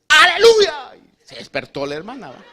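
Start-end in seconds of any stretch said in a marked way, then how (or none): 0.1-0.9 a high, loud cry out of nowhere; startling shouted hallelujah; sudden shout. Retold or imitated. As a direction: blast it to jolt the room awake.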